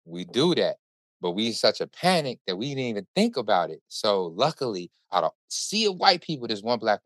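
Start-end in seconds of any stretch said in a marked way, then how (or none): none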